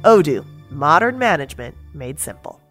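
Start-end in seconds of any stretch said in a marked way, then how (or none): none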